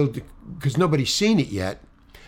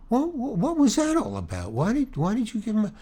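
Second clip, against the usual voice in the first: higher-pitched, stammering bewilderment; imitating incredulous confrontation; retold baffled questioning; 8 semitones higher, 3 dB quieter than usual. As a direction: stumble over the question in disbelief.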